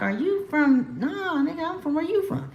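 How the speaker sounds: high-pitched mock question and retort; acted-out banter. People asking where she's from, high-pitched mock banter. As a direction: pitch it way up, playful, with a laugh at the end. Acts out exchange about her origins.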